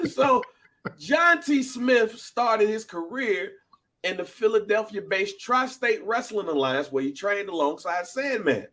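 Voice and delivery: high-pitched mock-lecture proclamation; dramatic fact announcement. Presents the wrestler's history.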